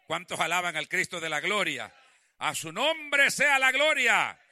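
loud, ringing pulpit voice; rally the congregation, slowing on the praise; full-force preaching. Real, not acted.

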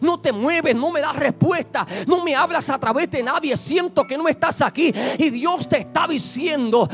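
usual speaking voice

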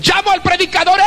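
ordinary delivery

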